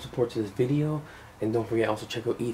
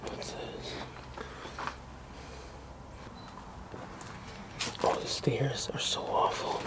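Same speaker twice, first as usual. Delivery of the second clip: hushed, careful whisper; 11 dB quieter, slower than usual. Real, not acted. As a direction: whisper while sneaking around. Sneaking through abandoned house.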